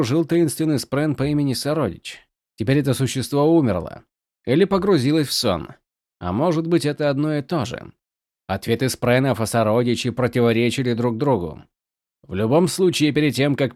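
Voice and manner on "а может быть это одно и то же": ordinary delivery, nothing marked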